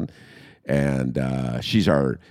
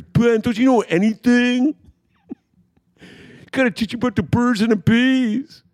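much higher, teasing know-it-all friend voice; 3 dB louder than usual. Retold or imitated. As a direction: act the smug friend who knows everything.

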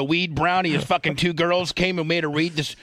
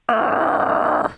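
frustrated groan; 10 semitones higher than usual. Real, not acted.

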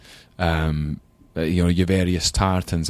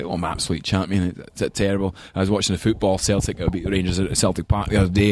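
light Glaswegian grandpa voice; a hint of old Glasgow man; Glaswegian accent; faster than usual. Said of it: "had a really strong Glaswegian accent".

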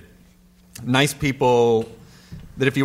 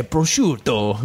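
put-on Italian accent; much slower than usual. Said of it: "I always go to Italian".

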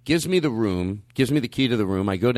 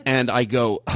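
ordinary talk

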